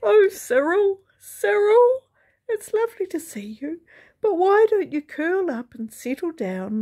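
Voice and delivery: high and teary, overcome at seeing him; weepy widow. A narrator's reading. Mrs Gimble weeping over her brother's visit, high and tearful. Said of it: "She wept into the teapot".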